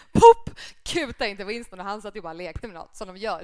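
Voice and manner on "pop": loud, high popping sound; imitated pop. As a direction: make a sharp pop noise. Sound effect in retelling.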